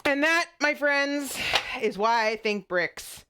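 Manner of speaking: mock grand conclusion. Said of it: "mock grand joke wrap-up".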